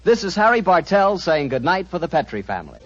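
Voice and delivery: bright radio announcer voice; announcer read. Acted. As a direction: read the credits like a broadcast. Closing radio credits.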